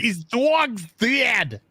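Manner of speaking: slow, drawn-out mobster threat; mock hitman. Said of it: "trying to put hits on people".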